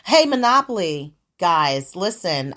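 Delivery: ordinary talk, nothing marked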